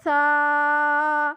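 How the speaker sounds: سا with a soft th, held long and level; tongue tip to upper teeth for سا, drawn out like "thaa"; soft Arabic tha. Shown as a demonstration. Teacher showing a soft letter, سا said as long th. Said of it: "یہ نرمی سے تب ادا ہوگا".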